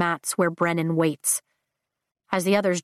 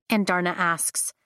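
ordinary delivery

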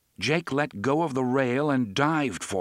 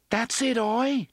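encouraging; 12 semitones higher than usual. A narrator's reading. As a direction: coax the frightened animal on. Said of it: "Jake encouraged".

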